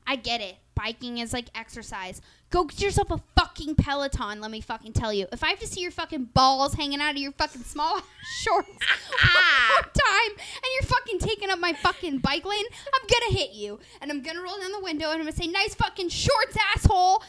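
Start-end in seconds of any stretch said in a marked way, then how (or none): 2.5-13.8 loud, rising comic tirade; worked-up, sweary mock threat; mock angry rant. 15.5-17.2 mock heckling yell. Heckling cyclists from car window.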